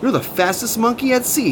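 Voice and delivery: high-pitched admiring exclamation; amazed. A narrator's reading. Captain admires Ketchup's fast mopping, amazed. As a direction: marvel at it, voice lifted high. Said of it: "The captain was absolutely amazed".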